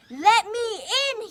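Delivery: loud high howl; howling plea. Acted. Big Bad Wolf howling at the duck's door. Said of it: "he howled".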